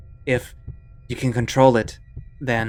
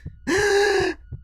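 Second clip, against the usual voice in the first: long high gasp rising; a rising, frightened gasp; panicked gasp. Acted. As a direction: drag in air in panic. Panic attack.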